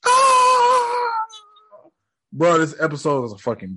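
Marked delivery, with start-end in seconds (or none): 0.0-1.1 high-pitched re-enacted scream; long shrieking "ah" in falsetto. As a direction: scream way up high in mock panic. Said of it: "I just started screaming too".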